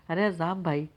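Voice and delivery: usual speaking voice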